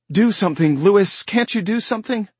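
high, loud pleading to her husband; panicked plea. Acted. Rachel begging Louis to help the baby, panicked and loud. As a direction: beg him desperately to help.

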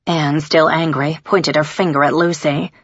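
ordinary delivery

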